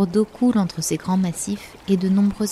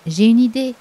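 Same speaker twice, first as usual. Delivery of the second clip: higher, louder exclamation as the bear; sudden bright idea; 4 semitones higher, 7 dB louder than usual. A narrator's reading. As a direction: announce your idea eagerly. (exclamation soudaine, forte et aiguë)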